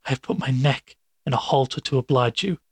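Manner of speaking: plain reading voice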